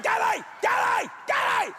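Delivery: high rising shouts of her name; frantic yell. Retold or imitated. Scalded husband yelling for his wife Kelly.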